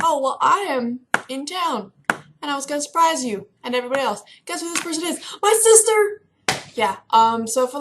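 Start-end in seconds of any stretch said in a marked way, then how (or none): 0.0-6.2 imitating excited sister. Sister springing her surprise visit on the phone, building to a squeal. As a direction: play the gleeful surprise, then burst out. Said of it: "She's like".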